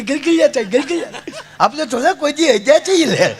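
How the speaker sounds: high voice, laughing through the words; a ticklish bride giggling out excuses; high giggly bride voice. Retold or imitated. Bride explains she is ticklish.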